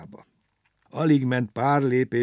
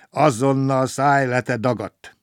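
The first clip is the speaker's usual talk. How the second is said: loud, higher, drawn-out order, easing off at the end; angry shout; 3 semitones higher, 7 dB louder than usual. A narrator's reading. Driver orders the passenger off the truck, angry shout. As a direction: yell the order crossly. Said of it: "Rákiáltott mérgesen".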